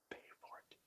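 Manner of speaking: hushed whisper passed across the table; secretive unvoiced whisper; whispering so the guest won't hear. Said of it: "She says".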